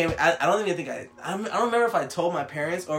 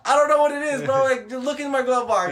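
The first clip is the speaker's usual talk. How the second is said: high, loud, laughing panic; much higher, louder panicked pleading to the cop; nervous panic; 12 semitones higher, 9 dB louder than usual. Retold or imitated. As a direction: blurt it like a panicked teen. Retelling nervous self to cop.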